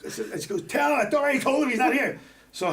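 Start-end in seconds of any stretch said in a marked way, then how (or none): 0.6-2.2 higher and louder, a brusque receptionist voice; mock the receptionist snapping back, pitched up. Receptionist telling off the caller, high and loud.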